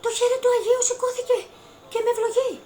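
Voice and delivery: high, loud, fast shriek; retold shriek. Woman shrieking to the crowd in the monastery. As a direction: shriek it out in disbelief. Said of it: "αρχινό τσιρίζω, φωνάζω μέσα στο μοναστήρι".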